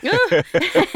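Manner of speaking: laughing as she says it's funny. Real, not acted. Laughing at the startled kids in the flash photo.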